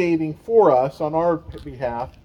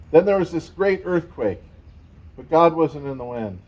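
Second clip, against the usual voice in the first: usual speaking voice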